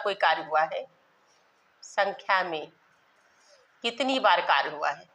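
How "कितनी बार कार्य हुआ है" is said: normal talking voice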